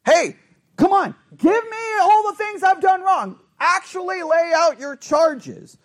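loud, high dare to accuse; direct challenge. Acted. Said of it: "it's an actual challenge by God".

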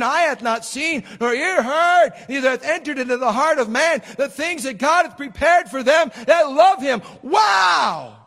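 loud, high preacher's fervor; preaching. Acted. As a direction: preach it to the back pews. Preaching at full force.

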